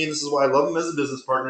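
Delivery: ordinary talk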